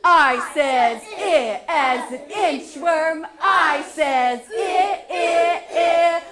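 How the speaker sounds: loud, punchy grunts; football player voice. Shown as a demonstration. Teacher chanting the sound like a football player. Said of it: "say it like a football player".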